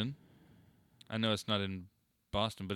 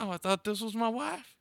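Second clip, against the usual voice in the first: put-on confused old man; 12 semitones higher than usual.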